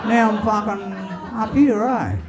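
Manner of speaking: high-pitched Aussie bloke; newly dead soul. Acted. Soul explaining his death to God, high Aussie voice. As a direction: pitch it high, a hapless newcomer. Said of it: "a soul comes up to heaven".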